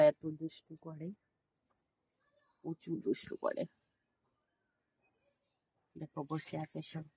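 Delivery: hushed talk. Real, not acted. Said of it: "আস্তে আস্তে কথা বলছি।".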